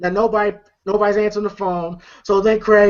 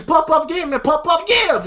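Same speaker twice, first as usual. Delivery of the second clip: higher-pitched, insistent nagging; mock scolding; 7 semitones higher than usual. Smoker telling a friend to share, high insistent scolding. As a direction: nag a friend in a raised pitch.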